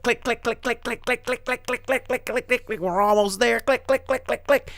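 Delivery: high, relentless ticking clicks; imitated chain-lift clicking. Coaster almost at the top, high ticking imitation. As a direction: mimic a ratchet climbing towards the drop.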